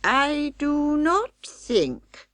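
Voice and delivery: Samuel Whiskers voice. Acted. Old rat doubting the pudding to his wife, slow and fussy. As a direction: drag it out doubtfully in a put-on rat voice.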